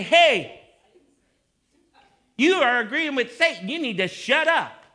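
loud, high-pitched pastoral telling-off; confrontational rebuke. Acted. Pastor confronting Brian in church, stern rebuke. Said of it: "and I say".

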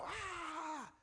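high, held, softened crowd "aah"; thin, sustained mock cheer; imitated crowd cheer. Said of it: "you hear the crowd go".

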